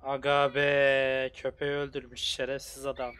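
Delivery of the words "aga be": drawn-out shocked cry. Real, not acted. Shocked at dead dog.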